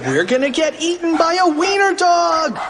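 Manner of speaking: high, frantic wail; hammed-up cartoon terror; panicked shout. A narrator's reading. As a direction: shriek it high as danger closes in. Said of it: "cried Cheeseball".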